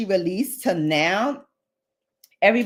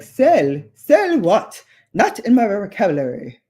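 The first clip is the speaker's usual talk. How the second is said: put-on a jokey posh London accent as Chanel; haughty mock British "Sell what?"; 4 semitones higher, 5 dB louder than usual. Vlogger imitating Chanel in a London accent. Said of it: "I did my best London accent".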